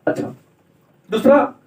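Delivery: ordinary talk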